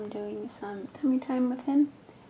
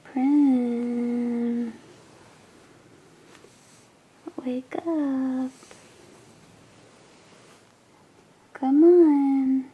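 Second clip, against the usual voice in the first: drawn-out, raised singsong calling; gentle wake-up call; 4 semitones higher, 7 dB louder, much slower than usual. Real, not acted. Mom to sleeping child, wake up, singsong calling. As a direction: coax a sleeper awake with long vowels.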